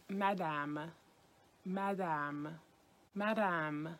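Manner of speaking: "Madame" with open French a's, stress at end; French pronunciation. Shown as a demonstration. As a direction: model "Madame" slowly, French style.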